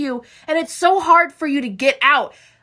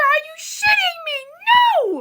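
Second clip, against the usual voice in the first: much higher, shouted disbelief; outraged shout; 12 semitones higher than usual. Real, not acted. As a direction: yell at the screen in outrage. Outraged at a plot twist.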